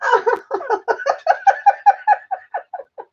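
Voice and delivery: long, high-pitched peal of laughter; helpless laughing fit. Real, not acted. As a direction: laugh until out of air.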